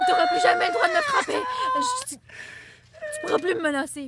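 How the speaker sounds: crying out, voice breaking; mock sobbing shout. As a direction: wail it through fake tears. Pretend fleeing abusive partner.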